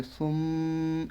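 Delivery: "সুম" with a long hummed m; slow nasal ending on "সুম"; ghunnah. Shown as a demonstration. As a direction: hold the final m of "সুম" through the nose. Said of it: "এটা গুণনা করব".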